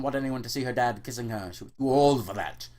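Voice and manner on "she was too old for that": ordinary delivery, nothing marked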